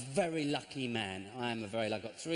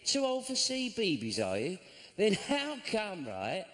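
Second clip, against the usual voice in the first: higher, slowed mock sarcasm to daughter; mocking sarcastic dad; 6 semitones higher than usual. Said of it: "I was gonna say".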